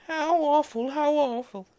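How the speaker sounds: high, loud cry of horror; the mother bursting into the dressing room, aghast; horrified mother. A narrator's reading. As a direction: wail it like she just saw a corpse.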